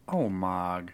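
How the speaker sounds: low half-orc name-saying; deep jutting-jaw voice. Shown as a demonstration. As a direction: push the jaw forward and drop the voice. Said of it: "jaw pushed forward in a deeper voice".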